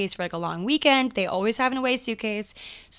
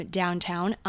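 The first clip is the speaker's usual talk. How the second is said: ordinary delivery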